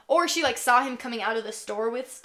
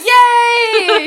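high, loud squeal of joy; loud cheer; 13 semitones higher, 17 dB louder than usual. Real, not acted. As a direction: shriek with delight. Happy eight ball answer.

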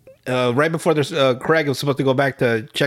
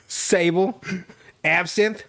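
normal talking voice

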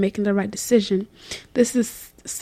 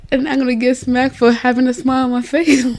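laughing; 3 semitones higher, 5 dB louder than usual. Real, not acted. Laughing at the breakup plan.

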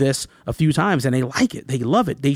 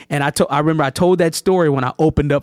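ordinary talk, nothing marked

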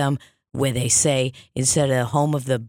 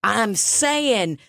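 high-pitched, drawn-out insistence; worked-up protest; 10 semitones higher, slower than usual. Pretend. Medium refusing to drop her accent for life, worked-up protest. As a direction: plead the case high and emphatic.

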